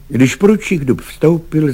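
plain reading voice

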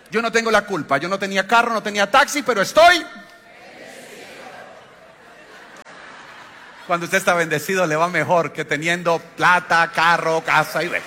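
loud, high fervent preaching; preaching at full force. Acted. Pastor preaching to his congregation, loud and high. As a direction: build to a loud high climax of praise.

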